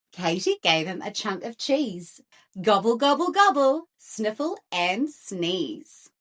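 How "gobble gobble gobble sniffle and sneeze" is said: usual speaking voice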